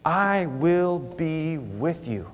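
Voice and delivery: slow, gentle promise of God; kind reassurance. Acted. God reassures the doubting Moses, slow and tender. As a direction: say it slowly and tenderly, like a comfort. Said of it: "the Lord is so kind".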